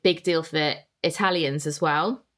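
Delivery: ordinary delivery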